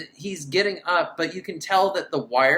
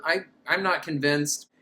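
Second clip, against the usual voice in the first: ordinary talk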